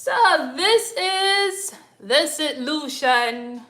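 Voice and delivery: high, loud, slow proud reveal; proud excited. Real, not acted. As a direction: announce it with delight.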